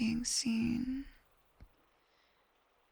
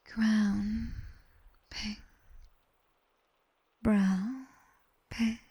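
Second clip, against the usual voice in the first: smoother whispered count, words and pings flowing together; slower than usual. Shown as a demonstration. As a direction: let each word glide into the next ping.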